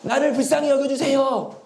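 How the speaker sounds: high-pitched pleading call; beggar crying out. Acted. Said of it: "소리를 지르기 시작했습니다".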